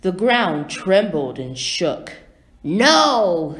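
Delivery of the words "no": loud, forceful storybook shout. A narrator's reading. Bizarro refuses to go home, loud acted shout. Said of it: "he shouted".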